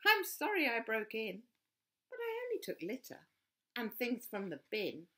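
high, quick apologetic voice; apologetic Rosie. A narrator's reading. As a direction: own up sweetly.

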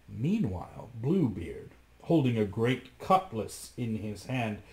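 ordinary delivery, nothing marked

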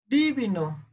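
DIvino, wrongly stressed on DI; stress on first syllable. Shown as a demonstration. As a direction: punch the DI at the start of "divino". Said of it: "Ahora vamos con divino". (divino con el acento cambiado a DI)

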